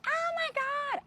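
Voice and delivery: high squealing oh my God in panic; panicked high squeal. Retold or imitated. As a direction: shriek it like a bug is on you. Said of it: "I'm like".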